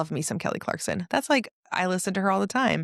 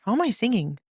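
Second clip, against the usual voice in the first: amazed; 6 semitones higher than usual. Retold or imitated. Retells amazed reaction.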